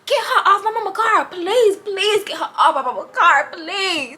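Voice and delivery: high-pitched mock begging; imitated desperate pleading. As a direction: plead like the car owner at his wits' end. Car owner begs to get her off.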